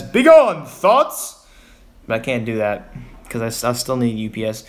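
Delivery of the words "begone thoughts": high, loud theatrical dismissal; mock banishing command. Streamer pretends to send UPS away, mock-dramatic command. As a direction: cast them out with hammed-up grandeur. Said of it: "I wanna tell U P S".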